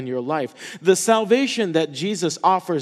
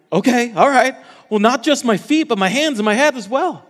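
higher, louder, rushing eagerness; eager Peter; 6 semitones higher, 5 dB louder than usual. Acted. Peter begging Jesus to wash him all over, eager. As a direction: blurt it out, overeager and keen.

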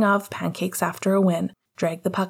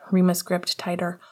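usual speaking voice

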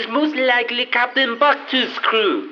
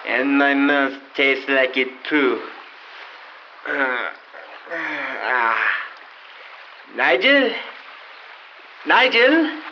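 put-on French accent; 8 semitones lower than usual. Frenchman wakes on beach.

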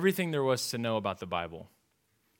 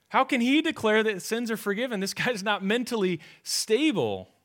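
high, louder, disbelieving; incredulous crowd; 9 semitones higher, 5 dB louder than usual. Acted. Crowd around Jesus doubting him, incredulous. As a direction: scoff that he's unstable.

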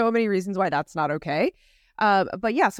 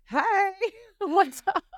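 laughing greeting; 13 semitones higher than usual. Retold or imitated. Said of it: "I was like".

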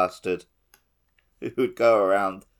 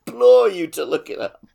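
laughing insistence; 14 semitones higher, 10 dB louder than usual. Real, not acted. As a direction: insist through giggles. Insisting on the Kellogg fact.

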